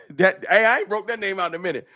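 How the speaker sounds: normal talking voice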